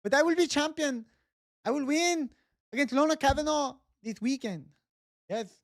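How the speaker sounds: put-on high, earnest Mexican accent, promising to be champion; Brandon Moreno impression. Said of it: "I can do the Baron de Moreno".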